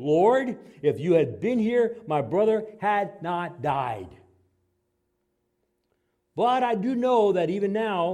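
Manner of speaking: high, loud, pleading cry to Jesus; pleading Martha. Acted. Martha pleading with Jesus, preacher voicing.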